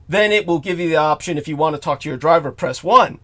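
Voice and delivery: normal talking voice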